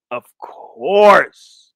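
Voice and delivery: "course" drawn out and swelling loud; emphatic last repeat. Real, not acted. Streamer capping his repeated grumble, "course" loud and drawn out. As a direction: lean hard and long on "course".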